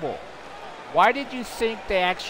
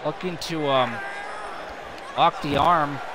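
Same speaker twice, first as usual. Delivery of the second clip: brisk, running wrestling call; play-by-play commentary; 2 semitones lower than usual. Acted. Commentator calling the match for viewers, brisk play-by-play. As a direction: keep the action rolling like a ringside caller.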